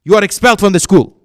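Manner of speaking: loud, fast, furious verdict; angry dismissal. Retold or imitated. Teacher expels the student in a loud, fast fury.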